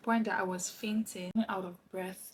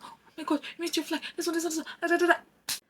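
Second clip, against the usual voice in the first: high-pitched mock panic, steadily high; retold motherly panic, pitched way up; imitating panicking mom; 9 semitones higher, faster than usual. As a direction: imitate a flustered mother on the phone. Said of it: "My mom was panicking".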